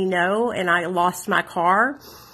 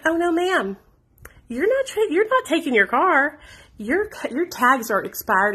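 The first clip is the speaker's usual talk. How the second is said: high-pitched imitation of a cop saying no; mock officer's refusal; 7 semitones higher than usual. Officer stopping a driver taking her car, mock high-pitched refusal. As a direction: imitate an officer firmly putting a stop to it.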